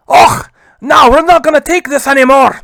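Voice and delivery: put-on loud, high, defiant Scottish accent. Scotland stands up against William Laud, indignant Scottish accent. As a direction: put on an indignant Scots burr. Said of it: "You like that little Scottish accent?".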